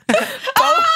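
high helpless laughter; laughing. Real, not acted. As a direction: crack up laughing. Laughing hard mid-story.

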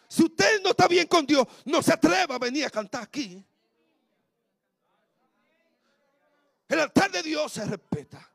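loud, high pulpit warning; preacher hammering home a warning to the worship team, loud; preaching at full force. Acted. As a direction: thunder it from the pulpit.

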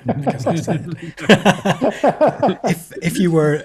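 words breaking into high laughter; laughing. Real, not acted. Host laughing at the guest's cheeky French, laughing. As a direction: laugh your way through it.

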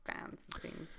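whispered aside, no voice. Real, not acted. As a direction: whisper the last words. Whispered aside about fans.

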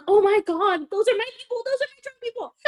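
high, rising squeal of mortified disbelief; shocked squeal. Retold or imitated. Narrator squeals on seeing her drunk friends fall. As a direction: wail it like you can't believe your eyes.

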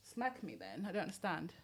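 bedroom voice. Acted. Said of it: "Is that your bedroom voice?".

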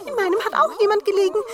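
hoch, laut und schnell; Zwerge rufen. A narrator's reading. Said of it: "kamen gelaufen und riefen". (hohe, laute Zwergenstimmen)

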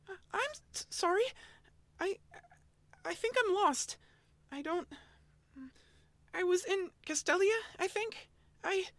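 high, stammering frightened voice; trembling. Acted. Elaine apologizing to the angry Oshawott, high frightened stammer. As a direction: stutter like a scared lost kid, high. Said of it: "She trembled".

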